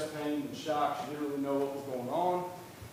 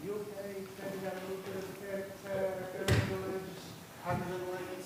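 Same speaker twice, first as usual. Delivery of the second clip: paramedic voice; 6 semitones higher, 3 dB quieter than usual. Retold or imitated. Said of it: "and they're like".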